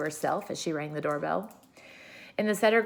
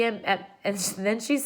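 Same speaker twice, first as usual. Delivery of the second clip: ordinary delivery, nothing marked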